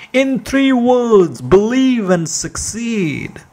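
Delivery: quoting a famous preacher with mild fervor; Norman Vincent Peale. Retold or imitated. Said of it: "Doctor Norman Vincent Peale said".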